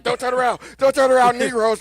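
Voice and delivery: panicked inner voice. Retold or imitated.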